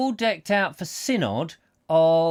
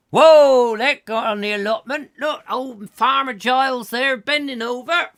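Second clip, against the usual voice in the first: much higher, loud playful voice with a whoop; excited; 10 semitones higher, 6 dB louder than usual. Pretend. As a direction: throw the sprouts and cheer like a kid. Playing sprout-throwing game at the table.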